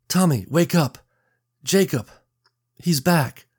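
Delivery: high, tearful cry of relief; rushing relief. A narrator's reading. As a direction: call out, bursting with relief. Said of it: "tears burst from her eyes".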